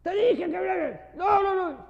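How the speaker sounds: high, loud heated argument; heated shouting. Acted. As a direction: shout over each other like arguing. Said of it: "Un italiano y un español se ponen a conversar".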